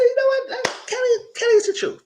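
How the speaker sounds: high, excited "Kelly is the truth"; excited praise. Retold or imitated. Vlogger retells cheering on Kelly, excited and high. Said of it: "That's what I said".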